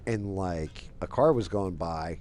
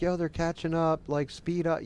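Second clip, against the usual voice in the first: much higher imitation of panicked kids; mock alarm, pitched way up; urgent warning; 10 semitones higher than usual. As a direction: urge the driver on from the back seat. Kids urging driver during chase.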